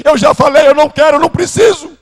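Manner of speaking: high, loud insistent refusal; father loudly insists he doesn't need it; exasperated father. Retold or imitated.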